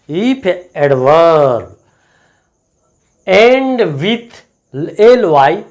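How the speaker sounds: slow, deliberate rule dictation. Shown as a demonstration. Teacher dictating an English grammar rule, slow and deliberate. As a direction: spell the rule out word by word for the class.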